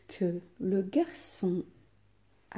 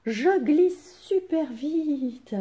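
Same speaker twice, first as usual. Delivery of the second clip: slow, stretched joyful exclamation; exclamatory tone; 6 semitones higher, 3 dB louder, much slower than usual. Shown as a demonstration. Teacher showing pupils how an exclamation sounds, joyful. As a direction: sing out "Je glisse super vite" with delight. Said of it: "vous entendez ma voix".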